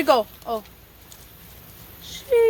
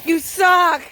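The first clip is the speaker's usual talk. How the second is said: usual speaking voice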